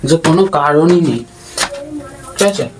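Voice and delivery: talking with a toothbrush in mouth; words round a toothbrush; while brushing teeth. Real, not acted. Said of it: "আর আমি এখন brush করছি".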